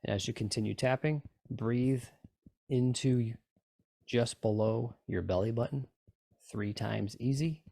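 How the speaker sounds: calm, even meditation guide voice; guided meditation. Acted. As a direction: guide them through the tapping gently. Leads a tapping meditation.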